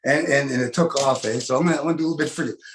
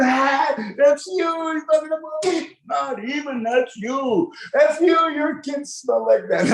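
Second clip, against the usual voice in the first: teasing laughter; 9 semitones higher, slower than usual. Retold or imitated. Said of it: "And they start".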